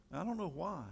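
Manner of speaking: usual speaking voice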